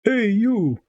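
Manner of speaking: high shouted call in a fake Eastern European Russian accent; bard's fake Russian accent. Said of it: "the kind of thing our bard would say".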